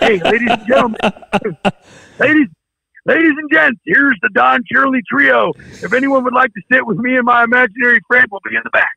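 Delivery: loud, high-pitched manic band introduction; hammed-up emcee announcing to the room; Gary Busey. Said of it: "It's me as Gary Busey".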